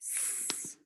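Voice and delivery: "S" as a long unvoiced hiss; isolated S sound. Shown as a demonstration. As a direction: hiss out "S" on its own. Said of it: "the first sound you hear is".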